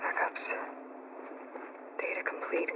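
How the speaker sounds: hushed, unvoiced murmur of wonder; awed whisper. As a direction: whisper it in disbelief at the find. Aloy awed at finding backups.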